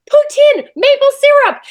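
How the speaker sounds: high, loud imitated shouts; excited crowd. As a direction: call out each item like a thrilled fan. Imitates crowd shouting Canadian things.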